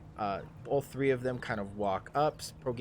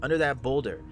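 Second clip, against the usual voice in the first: pointing out; 3 semitones higher, 6 dB louder than usual. Acted. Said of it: "and you're like".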